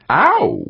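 sudden high-pitched yelp; pained yip. A narrator's reading. Stranded spaceman pulling a muscle, pained yip. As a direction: yip sharply in pain. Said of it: "Gaeta yipped".

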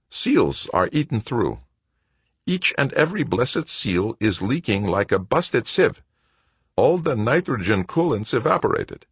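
high-pitched exasperated mechanic; exasperated Wojo. A narrator's reading. Wojo complains of the damage.

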